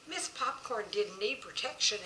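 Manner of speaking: ordinary delivery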